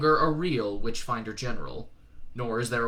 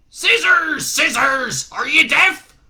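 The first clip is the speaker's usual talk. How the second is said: very loud, high shouting; furious bellow; 13 semitones higher, 13 dB louder, much slower than usual. Acted. Shadwell bellowing at Newton down the phone. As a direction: bawl at a deaf recruit.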